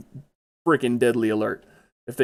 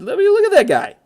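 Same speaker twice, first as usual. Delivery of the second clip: a high, loud put-on voice for the little robot; mock fancy voice; 7 dB louder than usual. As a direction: ham it up high to poke fun at the robot.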